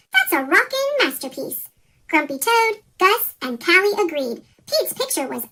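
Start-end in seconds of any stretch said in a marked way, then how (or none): none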